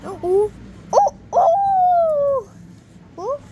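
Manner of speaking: very high, loud cartoon howl; high wolf howl. Mister Wolf announcing himself, high loud howling. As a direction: howl long like a little wolf. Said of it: "I'm Mister Wolf".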